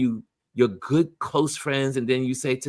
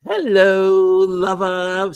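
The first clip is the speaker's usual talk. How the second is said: playful flirty hello, higher; flirty greeting; 5 semitones higher, 8 dB louder, much slower than usual. Pretend. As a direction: tease it like a sweet come-on. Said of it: "send her a little text and say".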